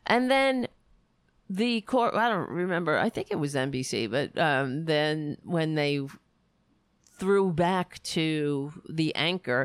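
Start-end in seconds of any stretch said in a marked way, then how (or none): none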